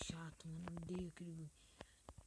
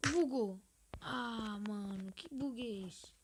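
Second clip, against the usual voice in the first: annoyed outburst; 2 semitones higher, 7 dB louder, slower than usual. Real, not acted. Game glitches.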